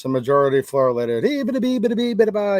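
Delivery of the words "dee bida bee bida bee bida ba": high, rattling nonsense syllables like an auctioneer; mock auctioneer gabble. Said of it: "broken English like an auctioneer".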